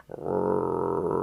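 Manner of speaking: low rumbling dog growl under the breath; low puppy growl. A narrator's reading. Narrator growls softly as the puppy Barkus. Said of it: "he growled".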